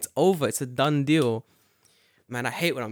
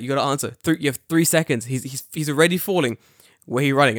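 pushy countdown; 7 dB louder, faster than usual. Retold or imitated. Friend pressing for an answer.

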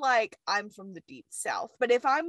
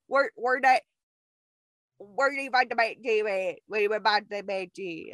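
put-on thick southern accent; 3 semitones lower than usual. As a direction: imitate an unintelligible southern customer. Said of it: "somebody who has a thick southern accent".

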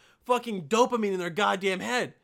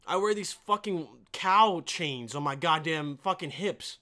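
ordinary delivery

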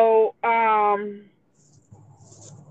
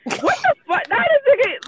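much higher, incredulous what the fuck; shocked disbelief. Real, not acted. Reacting in shock to an insulting text message. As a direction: jump up in pitch, stunned.

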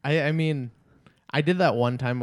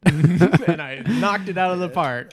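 slow, loud, laughing; laughing boast; 5 semitones higher, 8 dB louder, slower than usual. Real, not acted. Boasting of a failed accent, laughing. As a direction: brag through laughter.

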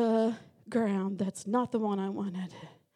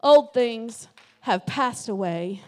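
loud, drawn-out exhortation building to a shout; rally them, stretching the words, louder and louder; rousing preaching; 2 semitones higher, 11 dB louder than usual. Real, not acted. Preacher urging the congregation to rejoice, loud rousing call.

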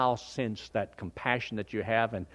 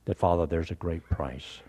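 closing prayer; 6 semitones lower than usual. Real, not acted.

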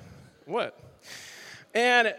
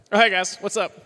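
ordinary delivery

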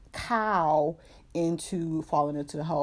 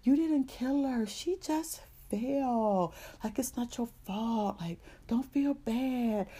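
high, gentle little teddy bear voice; the teddy soothing the boy in a high voice; Teddy's voice; 7 semitones higher, 3 dB quieter, slower than usual. Acted. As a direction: comfort him in a high toy-bear voice. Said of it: "Teddy is like".

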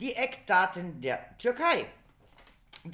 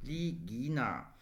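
lower, drawn-out syllables; slow sounding-out; 7 semitones lower, 4 dB quieter, much slower than usual. Shown as a demonstration. Learner repeating part of a Turkish toast, slowly sounded out. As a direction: stretch each syllable as if practising a foreign word.

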